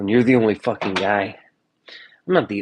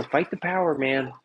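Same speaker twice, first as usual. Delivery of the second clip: higher, mocking slogan; mock rallying cry; 4 semitones higher, 3 dB quieter than usual. Mocking protesters' slogan, in a higher mock rallying cry.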